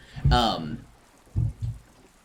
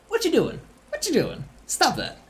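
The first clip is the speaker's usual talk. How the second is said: mock scolding.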